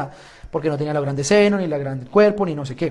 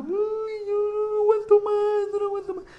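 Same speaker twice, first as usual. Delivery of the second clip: lloriqueo muy agudo y arrastrado; llanto fingido; 6 dB quieter than usual. Pretend. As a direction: finge llorar con un chillido lento y lastimero. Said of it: "me sentí a llorar". (llanto falso, exagerado y agudísimo)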